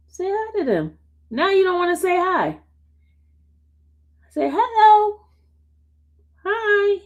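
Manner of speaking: high-pitched pet talk; sing-out high coaxing voice. Real, not acted. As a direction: coax the dog to say hello. Coaxing dog to greet viewers.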